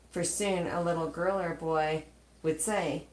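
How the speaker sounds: usual speaking voice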